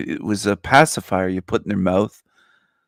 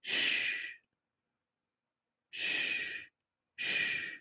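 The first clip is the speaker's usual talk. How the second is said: soft unvoiced shh to hush them; mock shushing; 16 dB quieter, much slower than usual. Shushing the angry little peasants, a soft mock hush. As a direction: shush them like fussing children. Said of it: "I'll tell them".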